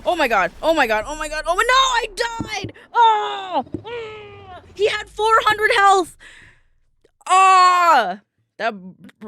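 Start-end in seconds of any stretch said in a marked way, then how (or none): 1.5-6.2 real outraged shout, high and loud. Real, not acted. Outraged at dying in the game. 7.3-8.2 anguished cry. Real, not acted. Cries out after losing.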